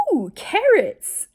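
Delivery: put-on high, drawn-out child's ooh; high, slow, delighted kid voice; kid's excited ooh. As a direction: mimic a thrilled little boy. Kid reacting to carrots, voiced.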